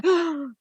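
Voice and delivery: sharp, high imitated gasp; mock horrified gasp. Colleagues reacting in meetings to menopause being mentioned, mock horrified gasp. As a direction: gasp in pretend horror. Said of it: "they were horrified".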